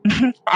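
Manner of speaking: short, dismissive chuckle; light laugh. Acted. Brother teased about emptying the foreign country, light laugh. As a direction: laugh off the teasing briefly.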